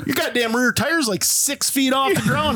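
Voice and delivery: high-pitched, incredulous report; astonished quote, pitched well up; retold amazement. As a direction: imitate a buddy's disbelief. Retold friend's amazement at tire height.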